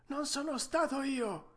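vocina acuta da vecchio falegname; mastro falegname. Acted. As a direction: rispondi in tono molto acuto, da anziano artigiano. (vocetta alta del falegname)

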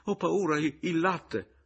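high, panicky, halting worry; timid man's fearful pleading; frightened stammer. A narrator's reading. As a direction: falter anxiously through it. Pomino frightened, still pleading.

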